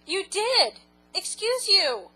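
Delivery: high, loud mock-indignant scold; teasing outrage at the husband; playful scolding. Real, not acted. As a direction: call him out for belching.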